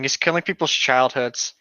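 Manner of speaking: normal talking voice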